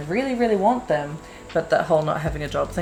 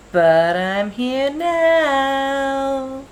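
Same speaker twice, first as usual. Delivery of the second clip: drawn-out cheerful; 9 semitones higher, 5 dB louder, much slower than usual. Real, not acted. Cheerful return to channel.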